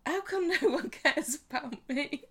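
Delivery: high-pitched mock wail, half laughing; hammed-up tearful high voice; self-pitying whine. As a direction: whine it like a neglected sulker. Acted self-pitying Chesney, hammed up.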